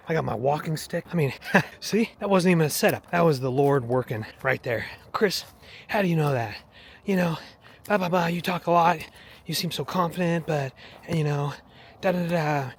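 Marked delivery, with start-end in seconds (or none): 5.1-12.7 mock skeptic, pitched higher; imitated doubter. Doubter challenges Chris about his faith, imitated higher. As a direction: put on a higher voice for a nagging questioner. Voicing a doubter who questions him.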